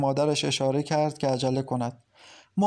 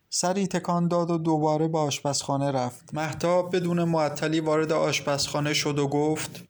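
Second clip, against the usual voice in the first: plain reading voice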